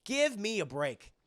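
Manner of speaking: slow, drawn-out retort; exasperated retort. Retold or imitated. Viewer fed up with the credits tribute, slowly exasperated. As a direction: spell out your exasperation word by word. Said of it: "I was like".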